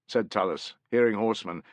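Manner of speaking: ordinary delivery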